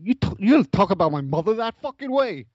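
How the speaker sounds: high, indignant mobster outburst; Robert De Niro. Acted. Mob patient to psychiatrist about his mother, indignant. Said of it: "talk to Robert De Niro".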